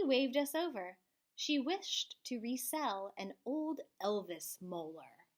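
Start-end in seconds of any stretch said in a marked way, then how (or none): none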